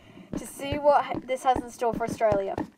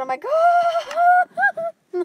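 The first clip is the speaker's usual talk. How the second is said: excited shriek; 6 semitones higher, 9 dB louder, slower than usual. Real, not acted. Overjoyed at marriage news.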